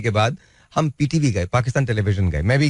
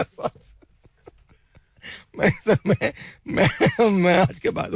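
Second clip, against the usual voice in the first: put-on flustered like a kid; 9 semitones higher, slower than usual. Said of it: "मुझे शिमला वाला बच्चा हो गया".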